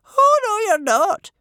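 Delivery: very high, loud contradiction; overruling chief judge. A narrator's reading. Judge refusing to let Bertha back in, firm high voice.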